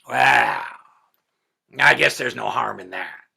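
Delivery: loud grudging hag; troll queen hag. A narrator's reading. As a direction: shrug it off like a scheming troll. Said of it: "she said".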